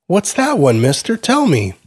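put-on high child's voice, demanding; pestering little boy. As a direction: nag like a small kid who won't let go.

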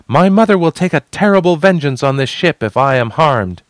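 high, loud warning; called out. A narrator's reading. Threatening the crew with her mother, high loud warning. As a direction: project it so the whole ship hears. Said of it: "she called, so everyone would hear".

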